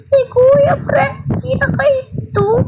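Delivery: playful fake sobbing over a stuck friend; mock crying. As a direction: wail over the frozen friend, hammed-up. Friend's avatar frozen, wailing.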